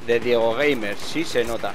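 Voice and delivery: ordinary talk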